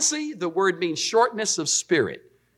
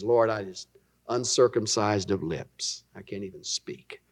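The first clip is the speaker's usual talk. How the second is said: low, soft, worn-out plea; defeated Moses; 8 semitones lower, 6 dB quieter than usual. Retold or imitated. Moses giving up before the Lord, trailing off low and soft. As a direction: let it sink to nothing. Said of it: "he finally just says".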